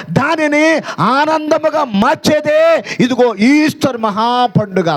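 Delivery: high, rhythmic declamation in phrases; impassioned preaching. Acted. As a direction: build a list on one high pitch, then stretch out the climax. Preaches at full pitch. (ఉద్వేగంతో లయబద్ధంగా ఎత్తైన స్వరంలో ప్రసంగం)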